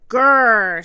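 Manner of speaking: loud, rolled grrr; puppy growl. A narrator's reading. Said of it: "said Sam".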